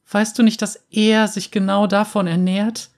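put-on high, urgent boy's voice; a scared boy warning his father; child voice. A narrator's reading. Frightened son warns his father.

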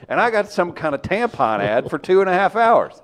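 loud, exaggerated complaining persona. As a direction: rant like an angry caller. Mock bigoted rant persona.

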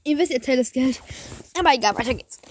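just swallowed, speaking again; words right after a sip; while sipping a drink. Real, not acted. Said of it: "ich muss kurz was trinken".

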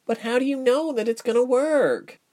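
high-pitched mousy question; timid, high-voiced doubt; small timid voice. A narrator's reading. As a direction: ask in a high little voice. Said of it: "Mouse whispered".

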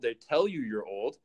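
ordinary talk

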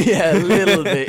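laughing out the words. Real, not acted. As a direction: giggle through an embarrassed admission. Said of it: "Biggest shock of your life?".

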